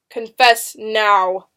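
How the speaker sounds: loud, slow, weighty command; stern demand. A narrator's reading. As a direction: demand it slowly and forcefully. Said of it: "Benjamin demanded".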